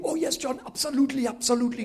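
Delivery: a hint of an excited preacher; evangelist's eager agreement. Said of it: "he's going on about Nigeria".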